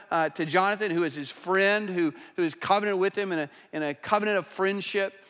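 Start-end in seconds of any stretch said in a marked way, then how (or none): none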